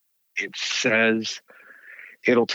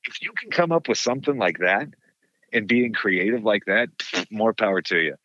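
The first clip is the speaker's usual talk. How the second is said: ordinary delivery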